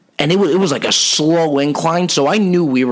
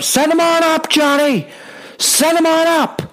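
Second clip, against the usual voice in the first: lift operator calling up. Retold or imitated. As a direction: holler it up the shaft like a bored operator.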